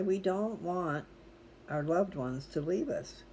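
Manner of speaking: usual speaking voice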